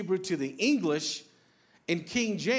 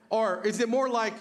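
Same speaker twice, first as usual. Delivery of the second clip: usual speaking voice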